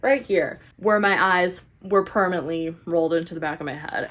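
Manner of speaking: ordinary delivery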